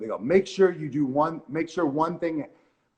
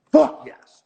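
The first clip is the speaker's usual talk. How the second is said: much higher, much louder victory yell; triumphant shout; 12 semitones higher, 11 dB louder than usual. Retold or imitated. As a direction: blurt it out like you just won the lottery. Retold triumph at getting the role.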